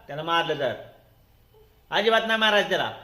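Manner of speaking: ordinary talk, nothing marked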